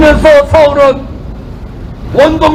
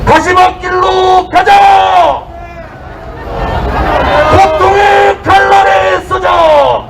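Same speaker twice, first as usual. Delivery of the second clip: roaring rallying speech; 3 semitones higher, slower than usual. Real, not acted. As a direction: roar it out to the crowd.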